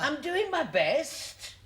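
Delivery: high, slow, pained protest; put-upon and defensive; harassed protest. Acted. Protests at being heckled.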